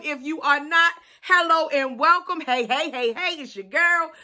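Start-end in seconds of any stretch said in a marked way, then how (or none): none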